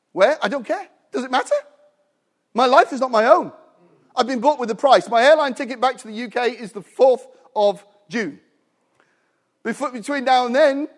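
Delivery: loud, high revival preaching; preaching at full force. Real, not acted. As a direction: proclaim it to the congregation.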